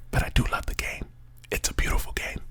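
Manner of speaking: soft, voiceless whisper; whispered aside. Real, not acted. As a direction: whisper it like a confession of love. Sudden hushed aside about the game.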